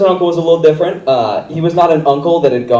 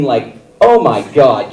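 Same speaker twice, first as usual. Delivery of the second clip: ordinary talk, nothing marked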